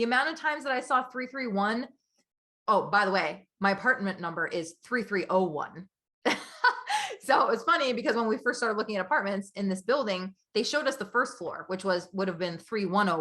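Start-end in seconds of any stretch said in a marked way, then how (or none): 6.2-7.1 high-pitched burst of laughter; amused laugh. Real, not acted. Telling listeners her apartment number, high amused laugh. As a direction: laugh at the coincidence.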